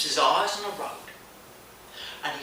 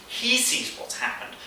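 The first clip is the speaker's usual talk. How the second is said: ordinary delivery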